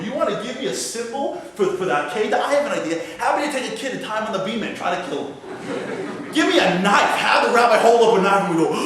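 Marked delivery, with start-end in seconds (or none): none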